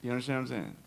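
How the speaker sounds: usual speaking voice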